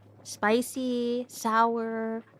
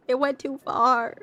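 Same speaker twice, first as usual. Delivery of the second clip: slow, louder, half-laughing lament; mock dramatic; 4 semitones higher, 5 dB louder than usual. Real, not acted. Admitting to chat the joke went too far, mock dramatic. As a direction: stretch it out like a mock tragedy. Said twice, second drawn out.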